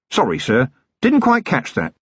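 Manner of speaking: sarcastic cop pretending not to hear; mock polite. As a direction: feign deafness to needle him.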